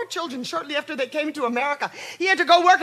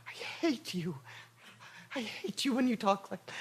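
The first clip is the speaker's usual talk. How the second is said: quiet bitter; 3 semitones lower, 13 dB quieter than usual. Acted. Mother bitter at mention of death.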